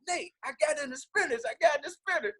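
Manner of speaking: excited news. Retold or imitated. [name] retells excited call.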